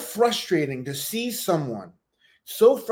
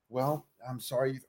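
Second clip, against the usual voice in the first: 1 dismissive; 5 semitones lower, 10 dB quieter than usual. Retold or imitated. Mimicking his dismissive apology.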